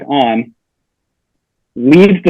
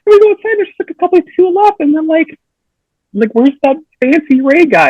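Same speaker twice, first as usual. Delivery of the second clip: incredulous; 13 semitones higher than usual. Retold or imitated. Retelling disbelief, very high.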